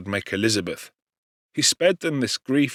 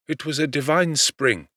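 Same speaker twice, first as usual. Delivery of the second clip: usual speaking voice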